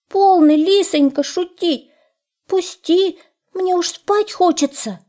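high, plaintive little hare voice; pleading hare. A narrator's reading. Hare begging the fox to let him in, high pleading. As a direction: beg in a thin high voice.

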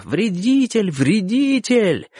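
high, drawn-out cries; loud yelling. A narrator's reading. Boys hounding a man in the street, high yelling. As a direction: chant it high and slow like a yelling mob. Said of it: "Громко вопя".

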